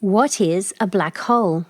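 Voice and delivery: ordinary delivery, nothing marked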